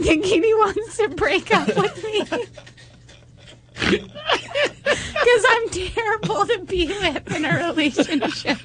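half sobbing, half giggling; laughing helplessly at own misery; laughing and crying. Real, not acted. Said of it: "in between laughing and crying".

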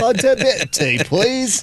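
mock British. Host teasing fake Brit caller with mock accent. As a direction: pretend Brit ordering tea.

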